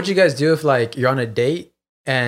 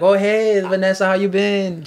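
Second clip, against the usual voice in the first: higher-pitched, breezy mock hello; overfriendly greeting; 6 semitones higher, slower than usual. Waiter greeting an ex on a date, mock friendly. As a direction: greet an old acquaintance too brightly, pitched up.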